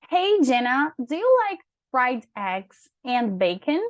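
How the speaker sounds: slow, clearly spaced English practice lines; model dialogue reading. Shown as a demonstration. Teacher playing letter A for a student to answer, slow model reading. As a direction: read it slowly and melodically for a learner to answer.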